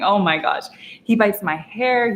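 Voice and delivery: normal talking voice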